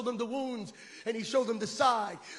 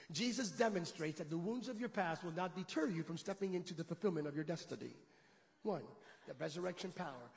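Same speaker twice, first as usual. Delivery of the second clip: much softer wrap-up; quiet summary; 6 semitones lower, 13 dB quieter than usual. Acted. Preacher summing up to the church, soft. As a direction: wind it down gently.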